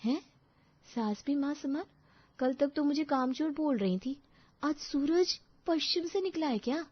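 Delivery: high-pitched, disbelieving wonder; incredulous aside. Acted. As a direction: puzzle over it in disbelief. Daughter-in-law doubts sudden sweetness. (हैरानी भरे ऊँचे सुर में)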